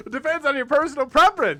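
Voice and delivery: loud high 1950s announcer; brash newsreel sponsor read; old-timey voice. Pretend. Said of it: "The old-timey voice".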